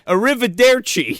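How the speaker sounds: mock Italian with an American mouth; Brad Pitt. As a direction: mangle the Italian accent on purpose. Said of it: "like Brad Pitt from Inglourious Basterds".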